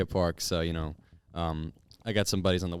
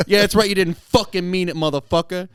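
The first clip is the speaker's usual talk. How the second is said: high, loud taunting threat; retold angry gangster taunt; menacing thug; 10 semitones higher, 10 dB louder than usual. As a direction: loom over the kid and taunt him. Armed driver mocks apology.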